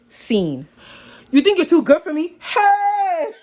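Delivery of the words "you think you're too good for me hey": high, loud mock outrage; scandalized disbelief. Storyteller repeating her date's accusation, scandalized. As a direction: climb higher into a squealed exclamation.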